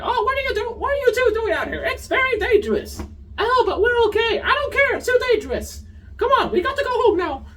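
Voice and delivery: very high squeaky cartoon mouse voice for a scolding elder; Mickey voice. Mother Coral scolding the twins, high Mickey-like squeak. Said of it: "she sounded like Mickey there".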